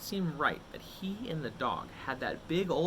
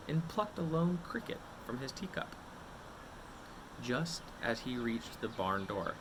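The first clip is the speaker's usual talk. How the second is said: ordinary delivery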